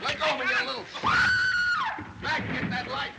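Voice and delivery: high-pitched angry yelling; angry struggle shout. Acted. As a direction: shout orders while fighting someone off. Struggle in the dark.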